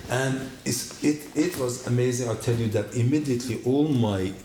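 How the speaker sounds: normal talking voice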